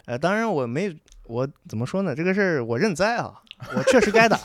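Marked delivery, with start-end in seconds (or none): 3.7-4.4 laughing admission. Real, not acted. Laughingly admits he deserved it.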